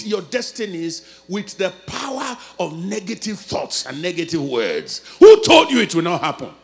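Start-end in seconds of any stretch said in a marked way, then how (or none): 5.2-6.5 loud rebuke to the crowd; challenging shout. Real, not acted. Preacher confronting the congregation with a loud question. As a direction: throw the question at them. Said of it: "preacher challenging the congregation".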